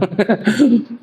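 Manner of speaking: amused chuckle; a quick laugh at the joke; short laugh. Real, not acted. Laughing at own filmi line.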